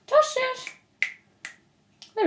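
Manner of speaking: very high little voice for a cat; pet-talk squeak. Real, not acted. Vlogger showing off her kitten Tosh, high pet-talk voice.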